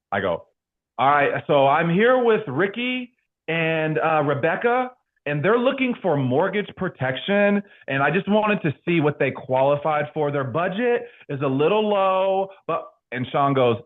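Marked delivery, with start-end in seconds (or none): 1.0-12.9 raised, over-proper sales voice; put-on insurance-agent voice. Agent reporting to mentor before clients, stiff high voice. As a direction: play the scripted phone salesman. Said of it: "Why in the F are you talking like that".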